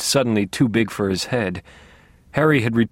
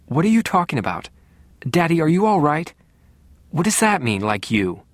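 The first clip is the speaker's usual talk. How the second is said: higher-pitched, urgent questioning; demanding; 5 semitones higher than usual. A narrator's reading. Sister confused by the talk between father and brother, demanding. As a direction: press for an answer in a higher voice. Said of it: "Deborah demanded".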